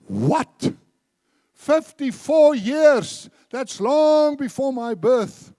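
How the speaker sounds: high, loud, slow disbelief; astonished. Retold or imitated. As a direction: gasp it out as the stunned cashier. Cashier astonished at long marriage.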